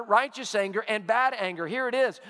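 normal talking voice

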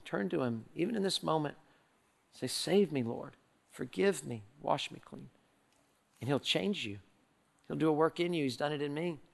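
low, soft prayer of surrender; hushed altar appeal. Acted. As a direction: ease down into a quiet, intimate invitation. Leads listeners in salvation prayer.

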